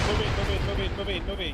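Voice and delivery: loud urgent commands; shouting orders over battle. Real, not acted. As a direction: yell the team forward. Squad leader pushing team in.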